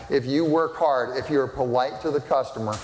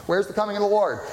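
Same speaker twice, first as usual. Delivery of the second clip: mocking taunt; 8 semitones higher, faster than usual. Quoting scoffers' taunt.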